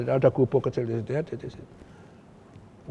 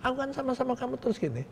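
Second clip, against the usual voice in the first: much higher and softer, a hammed-up worried rebel; rebel's worried reply; 9 semitones higher, 6 dB quieter than usual. Rebel leader answering the general's invitation home, in a high, soft, worried voice. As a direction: imitate the rebel's nervous protest, pitched way up.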